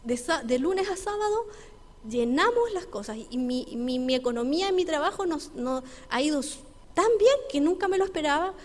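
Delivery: más alto y fuerte, eufórico; empresario entusiasmado. Retold or imitated. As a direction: cuenta la buena noticia con asombro. Businessman tells of surprising success. (el empresario le cuenta al pastor su éxito, emocionado)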